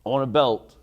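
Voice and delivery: ordinary talk, nothing marked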